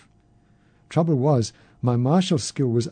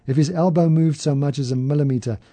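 ordinary delivery, nothing marked

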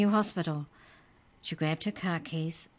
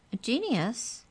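pleased squeak; 7 semitones higher than usual. Acted. Creature mishears, pleased.

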